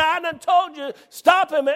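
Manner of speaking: high, loud mock yell; retold yell. Preacher yelling at his attacker in the retold fight, high and loud. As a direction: shout it like you're in the scuffle again.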